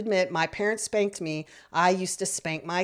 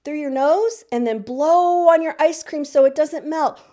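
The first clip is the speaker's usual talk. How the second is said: put-on high, louder sing-song voice for a small child; coaching a child to breathe; 7 semitones higher, 5 dB louder than usual. Shown as a demonstration. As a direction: coax the child through the breath like a game. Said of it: "Do that several times".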